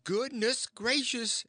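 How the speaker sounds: a startled aunt's outcry; alarmed exclamation. Acted. Aunt hearing the boy hasn't come, high alarmed cry. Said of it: "she says".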